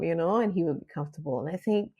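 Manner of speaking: ordinary talk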